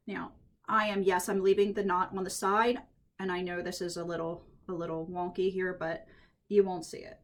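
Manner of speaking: ordinary talk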